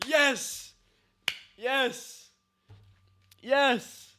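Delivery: high, loud "Yes!" again and again; triumphant shouts; cheering. Real, not acted. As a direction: cheer like a fan at a match. Cheering the character on.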